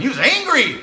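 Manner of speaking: high, loud exclamation; worked-up storyteller's shout; emphatic outburst. Retold or imitated. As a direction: punch out the word angry. Stressing the client's anger.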